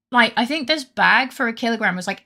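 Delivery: usual speaking voice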